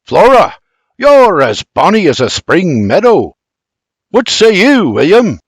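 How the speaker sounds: hearty Scots father exclaiming; proud exclaiming father. Acted. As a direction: boast about your daughter. Bibi exclaims.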